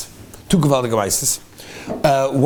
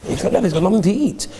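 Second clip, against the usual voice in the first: usual speaking voice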